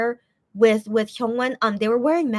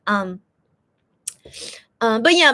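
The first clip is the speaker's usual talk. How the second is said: usual speaking voice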